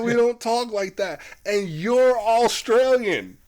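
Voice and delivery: high, insistent mock outrage, slowing on the last words; retold exasperated call-out. Chino calling out the Australian actor's put-on talk, exasperated. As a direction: imitate someone fed up, pitch way up. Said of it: "Chino calls him out once again".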